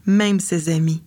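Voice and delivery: ordinary delivery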